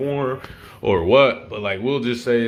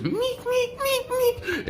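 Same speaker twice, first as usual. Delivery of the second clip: very high repeated squeaks; high squeaky hit noise; much slower than usual. Retold or imitated. Storyteller imitating a one-sided beating, high squeaky hit sounds. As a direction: squeak out a flurry of hits.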